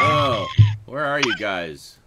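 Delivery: calling out loudly to friends. Real, not acted. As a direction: shout to find them.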